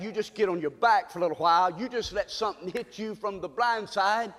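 usual speaking voice

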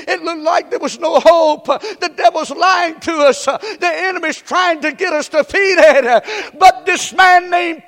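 fiery pulpit delivery; preaching at full force. Acted. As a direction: drive it home to the congregation. Preaching climb, louder.

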